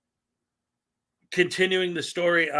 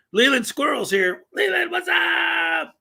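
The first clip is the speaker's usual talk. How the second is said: high, drawn-out excited call, louder; excited shout; 14 semitones higher, 4 dB louder, slower than usual. Real, not acted. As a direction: call out a friend's name with delight. Excited greeting to a chat viewer.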